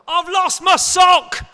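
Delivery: put-on loud, slow wailing shout of a child; child's shout. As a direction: wail it up the stairs like a kid who lost a sock. Child calling from upstairs.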